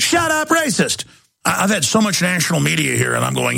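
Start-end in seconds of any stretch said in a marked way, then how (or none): 0.0-1.0 high-pitched sneering mock shout; mocking accuser. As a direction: squeal it like a hysterical accuser.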